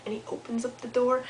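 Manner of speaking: hushed whisper. A narrator's reading. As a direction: sneak it out. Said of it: "quietly goes over".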